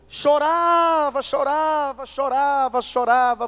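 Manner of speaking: drawn-out, high, loud repeats of weeping; mock-tearful, swelling cry; dramatized wailing. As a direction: stretch each word out like a long lament, easing off. Preacher dramatizes nightly crying.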